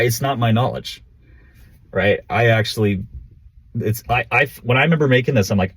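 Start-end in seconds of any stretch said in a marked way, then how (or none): none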